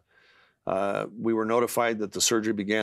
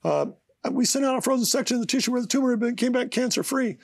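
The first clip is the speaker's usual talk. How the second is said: much higher voice mimicking his wife; imitating wife; 14 semitones higher, faster than usual. Husband retelling his wife's phone call, high excited voice. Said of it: "And she said".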